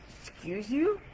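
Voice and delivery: taken-aback question with a rising end; indignant comeback, retold; mock offended. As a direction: retort as if wrongly accused, pitch climbing at the end. Retelling her offended reply to text.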